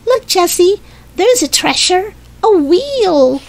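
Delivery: put-on high, delighted little-boy voice; small boy thrilled by his find; happy cry. A narrator's reading. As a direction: call out a happy discovery in a high voice. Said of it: "Suddenly he cried happily".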